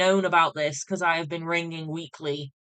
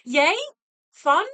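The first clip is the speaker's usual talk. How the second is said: high, louder mock cheer; sarcastic cheer; 9 semitones higher, 5 dB louder than usual. Real, not acted. Sarcastic cheer at bad news.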